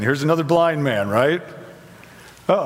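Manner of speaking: ordinary talk, nothing marked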